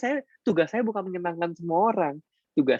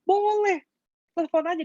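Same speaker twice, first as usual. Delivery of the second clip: much higher, bright welcoming yes; eager yes; 14 semitones higher than usual. Acted. Coach eagerly invites a sick member to call, much higher. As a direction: jump in gladly, pitched way up.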